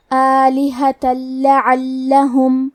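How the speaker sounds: "آلہۃ لعلہم" chanted slowly, tanween melting into a doubled L without hum; slow tajweed chant of "آلہۃ لعلہم" with firm double L; merging without ghunnah. Shown as a demonstration. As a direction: merge straight into the lam of "لعلہم", no nasal hum. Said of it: "لام میں ملا کے بغیر غنہ".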